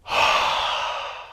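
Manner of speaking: long open-mouth breathy exhale; steamy exhale through a wide mouth; ocean breath. Shown as a demonstration. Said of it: "Exhale through an open mouth".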